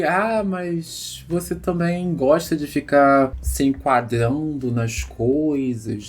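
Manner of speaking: fala arrastada, imitando a crítica do ex; imitando ex condescendente. Retold or imitated. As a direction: estique as palavras como quem dá uma alfinetada. Ex's remark acted out slowly. (imitando o ex com condescendência, bem devagar)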